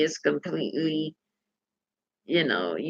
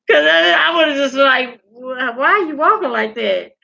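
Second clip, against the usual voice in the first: shouting it out, voice cracking high; mock yell; 7 semitones higher, 4 dB louder than usual. Yelling at the walking creature, high and loud. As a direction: yell at the thing like it's wrong.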